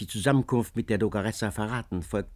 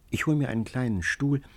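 plain reading voice

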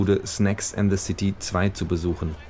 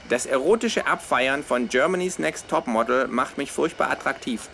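lightly higher, smug kangaroo; 7 semitones higher than usual. Acted. Kangaroo explaining its word game to flatmate, lightly voiced. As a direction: explain it like a pleased know-it-all.

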